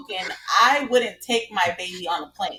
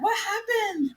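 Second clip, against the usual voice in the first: higher, innocent mock concern from a lightly voiced mom; 11 semitones higher than usual. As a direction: play the oblivious mom with fake worry. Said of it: "And I was like".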